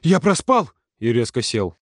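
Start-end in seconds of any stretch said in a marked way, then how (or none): 0.0-0.7 alarmed high, louder cry; horrified. A narrator's reading. Boy waking, thinking he overslept, horrified. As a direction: blurt it out in sudden panic. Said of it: "потом в ужасе".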